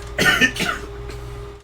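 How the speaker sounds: dry cough from a sore throat; throat-strained cough. Real, not acted. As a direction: cough mid-line from vocal strain. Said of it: "Warrior's voice hurts my throat".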